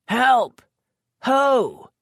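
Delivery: high, loud shout for help; calling for help. Acted. Lisette shouting for rescue, high and loud. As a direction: yell through the door. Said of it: "Elf screamed".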